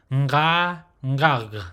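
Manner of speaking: "nga" held open, "ngag" closed at the throat; nasal onset on "nga" and "ngag"; guttural nasal demonstration. Shown as a demonstration. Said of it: "is pronounced".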